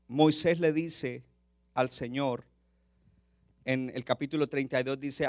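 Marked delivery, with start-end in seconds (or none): none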